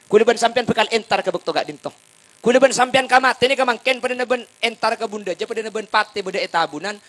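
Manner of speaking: urgent-paced, emphatic proclamation; forceful preaching. Acted.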